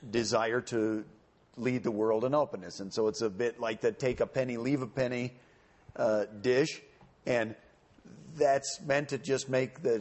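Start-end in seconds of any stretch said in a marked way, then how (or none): none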